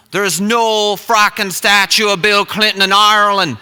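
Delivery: exasperated imitation of an Irishman; emphatic Irish accent. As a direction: insist loudly in a put-on Irish accent. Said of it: "this accent".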